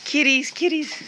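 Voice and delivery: very high sing-song cat call; calling kitties. Real, not acted. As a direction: lure the cats up high. Calling cats.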